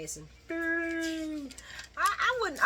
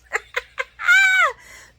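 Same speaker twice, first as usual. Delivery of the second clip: loud high laugh; 11 dB louder than usual. Real, not acted. Laughs at Lulu's situation.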